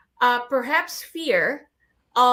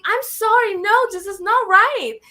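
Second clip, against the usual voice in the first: high, raised mock protest; retelling outrage, voice pitched up; indignant protest; 8 semitones higher, 5 dB louder than usual. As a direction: push it up high in disbelief. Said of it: "I'm like".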